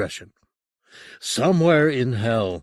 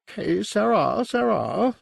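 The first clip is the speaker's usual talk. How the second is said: mocking singsong; 11 semitones higher, slower than usual. Jack taunting the demon.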